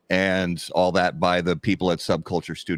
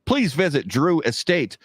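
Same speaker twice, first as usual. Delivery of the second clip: ad read; 4 semitones higher than usual. Acted. Reads sponsor ad.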